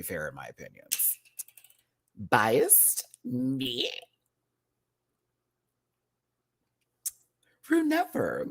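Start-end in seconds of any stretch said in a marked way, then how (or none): 2.2-8.5 playfully high, feigning innocence; mock innocent. Host to viewers, lightly mock-innocent. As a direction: act shocked anyone would call you biased.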